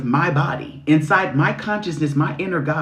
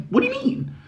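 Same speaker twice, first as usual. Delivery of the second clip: much higher, laughing disbelief; mock exasperation. Mocking people judging a stressed mother, high laughing squeak.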